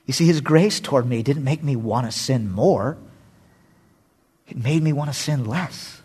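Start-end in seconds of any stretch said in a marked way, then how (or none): none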